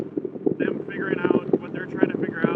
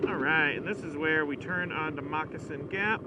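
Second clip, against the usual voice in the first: muffled helmet mic over wind noise; inside a helmet; 2 semitones lower, 8 dB louder than usual. Real, not acted. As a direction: talk inside a motorcycle helmet while riding. Riding, talking into helmet mic.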